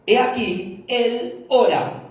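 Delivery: "he aquí, él ora" high, loud and very slow, each word set apart; slow emphasis. Acted. Preacher telling the congregation to take note, slow high emphasis. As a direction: spell it out slowly so nobody misses it. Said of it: "tomen nota de esto".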